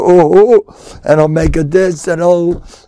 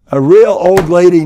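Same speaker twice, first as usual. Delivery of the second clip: ordinary talk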